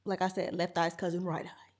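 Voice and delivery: ordinary talk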